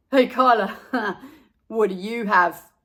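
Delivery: loud, mocking tease with a laugh; teasing. A narrator's reading. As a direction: taunt the odd kid. Buster teasing Carla.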